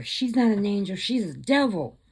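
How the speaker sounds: mildly heated, imitating an alarmed accuser; acted accusation.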